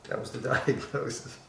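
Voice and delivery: words breaking up into laughter; laughing while talking. Real, not acted. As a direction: try to get it out through a laugh. Laughing through the remark.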